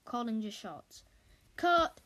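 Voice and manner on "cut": loud, high director's yell. Acted. Director yelling cut at the actors, loud yell. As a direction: bark it across the set. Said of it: "yelled Mister Big Shot".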